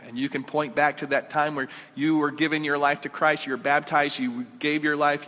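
ordinary delivery

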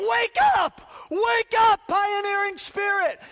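loud high aggressive yell; aggressive shout. Retold or imitated. Pastor replaying last Sunday's charge, aggressive shouting. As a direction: drive them awake with force. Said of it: "I was getting aggressive".

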